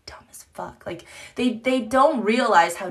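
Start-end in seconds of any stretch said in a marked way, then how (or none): 0.1-0.8 a secret whispered insult; whispered aside. Real, not acted. Mom whispers an insult to viewers, hushed. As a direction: whisper it under your breath.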